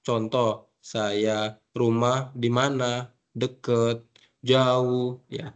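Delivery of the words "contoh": ordinary delivery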